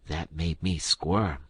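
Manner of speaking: plain reading voice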